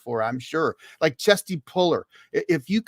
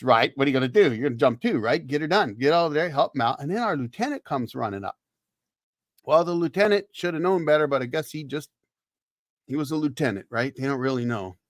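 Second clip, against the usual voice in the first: ordinary talk, nothing marked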